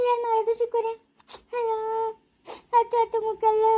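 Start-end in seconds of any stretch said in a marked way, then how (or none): 0.1-1.8 high, sobbing lament; crying. Acted. Jaguar to Chikkanna about her drunk husband, high and sobbing. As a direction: wail the question through tears. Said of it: "ಯಾಕೆ ಜಾಗ್ವಾರ ಅಳ್ತಾ ಇದ್ದ್ಯಾ?".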